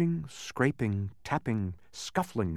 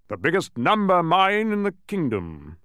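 loud, high, swelling boast; proud; 7 semitones higher, 6 dB louder than usual. A narrator's reading. Mathematician showing off his mine, proud and loud. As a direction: proclaim it with swelling pride. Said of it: "said the mathematician proudly".

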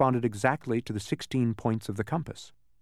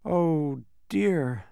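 higher, gently dejected voice; sad and soft; 6 semitones higher than usual. A narrator's reading. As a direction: sigh it out sadly as a child would. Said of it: "said Milo sadly and softly".

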